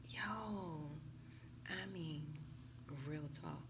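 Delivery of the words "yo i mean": ordinary talk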